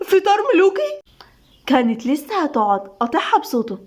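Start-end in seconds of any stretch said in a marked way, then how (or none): 0.1-1.0 very high, dazzled exclamation; amazed. A narrator's reading. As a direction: marvel at a royal feast. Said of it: "أسيل بانبهار". (بانبهار شديد)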